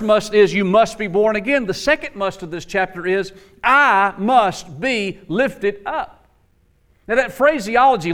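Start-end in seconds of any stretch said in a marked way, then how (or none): 3.6-6.3 drawn-out, word-by-word declaration; slow, deliberate quote. Retold or imitated. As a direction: space out each word solemnly. Quoting Jesus with deliberate emphasis.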